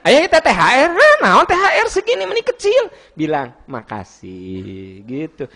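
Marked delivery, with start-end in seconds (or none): 0.1-2.9 very high, loud, fast-rising complaint; mock indignant wife. Acts wife complaining about small bonus. 3.7-5.0 soft, low, long drawn-out thank-you; gentle thanks. Shown as a demonstration. Models the proper thanks after complaint.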